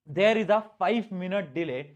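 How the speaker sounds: very slow English dictation; dictating slowly. Shown as a demonstration. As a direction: spell it out slowly for notes.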